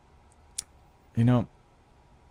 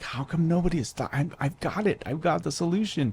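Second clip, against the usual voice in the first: triumphant inner thought; 7 semitones higher, 3 dB quieter than usual. Retold or imitated. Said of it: "I'm like".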